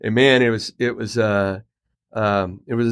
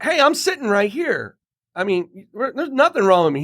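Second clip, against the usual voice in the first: high indignant voice; 8 semitones higher than usual. Retold or imitated. Retold complaint to stranger.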